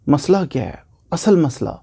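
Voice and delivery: ordinary talk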